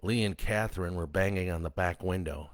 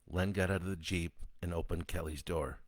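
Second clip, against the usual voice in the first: ordinary delivery, nothing marked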